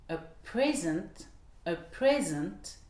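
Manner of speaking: PRE stressed in "present", said slowly; slow "a PRE-sent", second syllable light; noun stress. Shown as a demonstration. Teacher gives the noun for a gift, stress on PRE in "present". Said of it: "entre les noms et les verbes".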